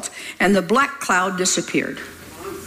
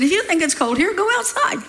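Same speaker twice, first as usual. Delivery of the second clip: dad's retort; 9 semitones higher than usual. Retold or imitated.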